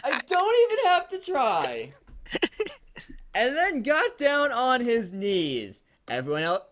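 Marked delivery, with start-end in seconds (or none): 0.0-1.9 very high, louder voice full of laughter; real giggly squeal; laughing squeal. Real, not acted. Reader still amused by the text, laughing squeal. 3.3-5.8 high, slow, loud reading on the edge of laughter; fighting laughter. Real, not acted. Reader resuming the story, fighting laughter.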